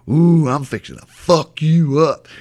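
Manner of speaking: slow, loud, drawn-out threat; mock threat. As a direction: imitate a kid menacing his opponent. Retold kid threatening a smaller player.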